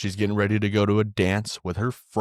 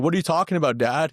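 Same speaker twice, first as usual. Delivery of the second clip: put-on higher, louder puzzled teen voice; confused daughter; 8 semitones higher, 4 dB louder, faster than usual. Daughter questioning her dad, higher and puzzled. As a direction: ask like a baffled teenage girl. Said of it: "She's like".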